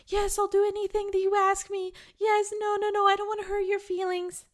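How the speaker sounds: high, fast, anxious people-pleaser; eager people-pleaser. Retold or imitated. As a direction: squeak it out like you'd do anything to please. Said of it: "shapeshifters are like".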